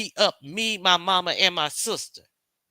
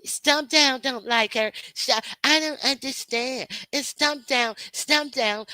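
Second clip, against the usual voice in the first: mocking whiny critic; 6 semitones higher than usual. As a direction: parody her as a clueless, complaining critic. Mocking the critics about Stomp Down.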